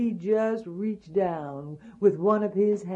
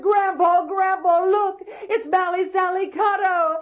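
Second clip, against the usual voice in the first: put-on much higher, louder child's cry; higher boy voice tugging at grandpa; little boy calling; 11 semitones higher, 4 dB louder than usual. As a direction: call urgently as a small boy.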